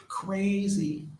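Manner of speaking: amazed high. Real, not acted. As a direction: lift "Crazy" way up in disbelief. Repeats the word in awe.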